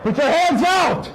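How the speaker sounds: shouting command. Real, not acted. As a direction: shout the order across the lot. Officer orders downed suspect.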